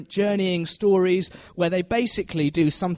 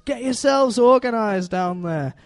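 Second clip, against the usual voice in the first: higher, drawn-out cartoon northern English voice; Wallace impression; 5 semitones higher, 4 dB louder than usual. Wallace to the bundle of sheep, put-on slow, higher voice. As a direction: stretch it out slowly, pitched up, in amateur Yorkshire style. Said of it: "where Wallace looks down".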